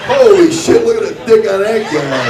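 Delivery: very high, loud shriek, then fast; shocked exclamation. Pretend. TV viewers reacting to his jump, shrieking in shock. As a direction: squeal it in shock. Imagined viewers gasping at him.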